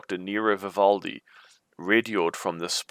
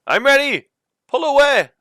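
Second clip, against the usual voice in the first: much higher, much louder shout upward; calling up; 12 dB louder than usual. A narrator's reading. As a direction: bellow up to the rope team. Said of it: "you call up to the top".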